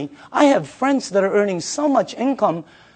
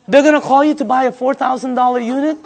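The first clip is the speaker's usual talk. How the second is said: incredulous, laughing through the retold words; laughing disbelief; 4 semitones higher, faster than usual. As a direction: laugh it off as if it's absurd. Retelling his disbelief on the phone.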